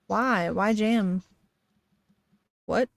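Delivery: ordinary talk, nothing marked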